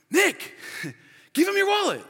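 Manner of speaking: high-pitched imitation of an alarmed girlfriend; mock girlfriend. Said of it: "his girlfriend's like".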